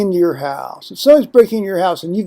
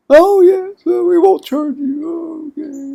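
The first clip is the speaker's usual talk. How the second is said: much higher, light mock voice of a phone rep; imitating phone rep; 11 semitones higher than usual. Telling the phone rep's answer in a high mock voice. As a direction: play the rep as a chirpy pushover.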